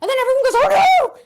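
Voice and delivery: high, fast, frantic lady; frantic noblewoman rant. Acted. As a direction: gabble it in a panic.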